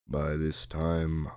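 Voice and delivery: plain reading voice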